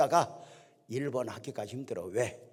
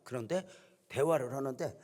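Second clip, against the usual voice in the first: ordinary talk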